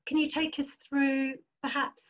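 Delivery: ordinary delivery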